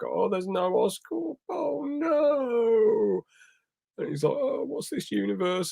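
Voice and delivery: put-on high, wavering old man, close to tears; a bewildered old man crying for his lost home. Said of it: "he seems like a lost old man".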